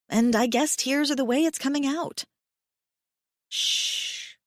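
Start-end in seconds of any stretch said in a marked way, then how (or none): 3.5-4.3 breathy soothing hush; shushing. Acted. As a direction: hush her gently. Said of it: "he tells me".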